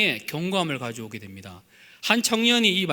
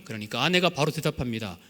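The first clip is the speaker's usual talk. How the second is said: usual speaking voice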